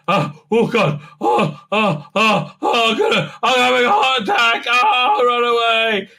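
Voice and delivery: mock breathless panic. Mock panting heart attack.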